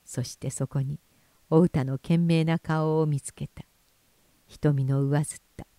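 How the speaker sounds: ordinary delivery, nothing marked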